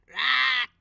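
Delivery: Rar shouted as a loud roar; roared name. Shown as a demonstration. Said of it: "It is a K on the end".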